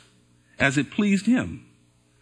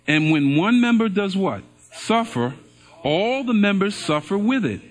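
louder, slow, measured Bible reading; scripture reading; 2 semitones higher, 3 dB louder than usual. Acted. Pastor reading verses to the congregation, loud and measured. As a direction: proclaim each verse to the room.

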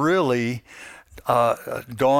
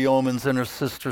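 ordinary talk, nothing marked

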